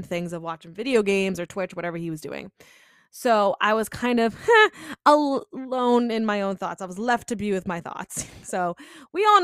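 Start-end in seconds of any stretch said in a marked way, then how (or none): 4.4-5.0 quick burst of high laughter; short high laugh. Real, not acted. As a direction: let a bright laugh escape. Laughs while recounting being alone.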